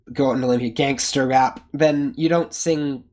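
usual speaking voice

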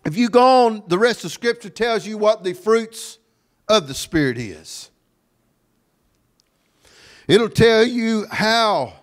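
high, ringing preacher's voice; forceful preaching. Real, not acted. Preacher pointing the congregation to Scripture, forceful preaching. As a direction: proclaim it, stretching the final words. Resumes raised preaching after coughing.

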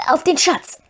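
high creature voice swearing an oath; Gollum. A narrator's reading. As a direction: pitch it up as a wheedling creature. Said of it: "sagte Gollum plötzlich mit klarer Stimme".

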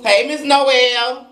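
high, slow sing-song call of Miss Noel. Real, not acted. Calling out to a viewer across the stream, high drawn-out call. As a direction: stretch the name out as if calling across the room.

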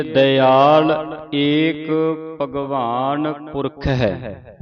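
drawn-out careful reading of the verse; very slow model reading for students; very slow recitation. Shown as a demonstration. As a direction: stretch each word slowly. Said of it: "ਇਸ ਨੂੰ ਦਿਆਲ ਬੋਲਣਾ ਹੈ".

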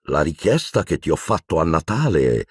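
plain reading voice

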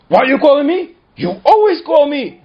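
whining shout; whiny yell. Retold or imitated. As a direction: complain like a put-upon kid. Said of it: "Chaim says".

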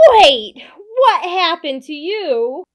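loud, slowed upset shout; loud dismayed cry. Acted. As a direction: demand to know what happened. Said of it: "He shouts".

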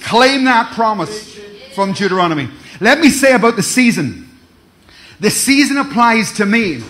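loud declaring preacher; preaching. Real, not acted. Pastor declaring a promise to church, loud preaching. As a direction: proclaim it to the congregation.

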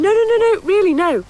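loud, high string of no's; urgent pleading. Acted. Producer loudly begging the great-nephew to stay. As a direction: stop him leaving, rising and loud. Pleading him not to leave.

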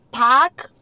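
"Park" said Boston-style, no R; Boston accent. Shown as a demonstration. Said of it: "a Boston accent".

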